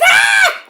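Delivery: shrill mock scream, very high and loud. Wrestler screams in fright when her opponent pops up, high and loud. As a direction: scream like a scared little girl. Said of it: "Kaylani's like".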